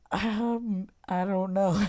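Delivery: high, laughing mumble through giggles; giggly high-pitched I don't know; laughing reply. Retold or imitated. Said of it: "he just starts laughing".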